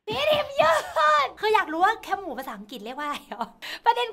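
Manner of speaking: squealed, stretched-out protest; exasperated cry. Real, not acted. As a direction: wail the name in mock outrage. Cries out at manager's pick.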